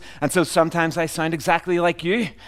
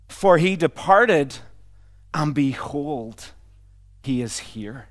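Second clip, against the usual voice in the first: solemn quotation; slower than usual. Retold or imitated. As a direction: let each phrase settle, easing down to a hush. Reciting Augustine's saying solemnly, slowly.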